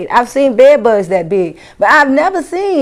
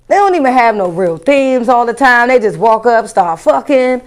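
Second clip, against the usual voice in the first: normal talking voice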